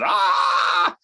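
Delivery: sharp high martial-arts yell; karate shout. Retold or imitated. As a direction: bark out a karate kiai. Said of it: "And he goes".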